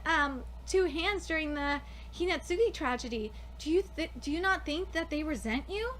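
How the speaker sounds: somber character reading. Acted. Reads manga dialogue.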